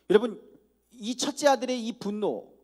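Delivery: ordinary delivery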